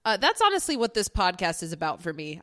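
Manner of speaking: ordinary delivery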